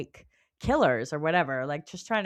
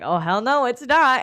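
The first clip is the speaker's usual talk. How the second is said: a louder, defiant "hell no"; 6 semitones higher, 8 dB louder than usual. Retold or imitated. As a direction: Manon flares up and shoots it down. Manon voiced, defiant.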